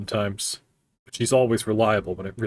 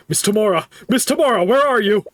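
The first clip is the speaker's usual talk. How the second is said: louder, higher desperate calling; 9 semitones higher, 6 dB louder than usual. Acted. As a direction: call for her across the hallway.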